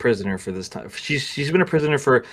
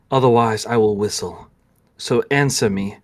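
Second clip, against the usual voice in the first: ordinary talk, nothing marked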